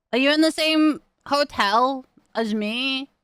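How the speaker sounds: usual speaking voice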